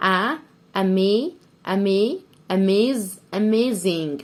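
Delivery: paused, growing "أ، أمي، أميز، أميزنج"; syllable build-up. Shown as a demonstration. Teacher building amazing for pupils, piece by piece. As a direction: slowly add a piece to "أميزنج" each time.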